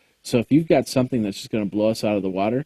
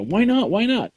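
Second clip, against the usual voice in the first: whiny fan; 10 semitones higher than usual. Retold or imitated. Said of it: "he actually texted me".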